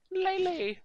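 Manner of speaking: very high, loud cry of the name; acted alarmed cry. Calling to Lanley during the stabbing, alarmed high cry.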